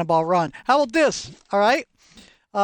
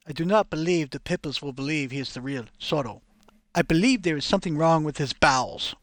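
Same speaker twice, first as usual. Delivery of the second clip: put-on low, measured Spanish-accented Zorro; lightly voiced Spanish accent; 3 semitones lower than usual. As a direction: speak as a grave Spanish swordsman. Real Zorro interviewing, Spanish accent.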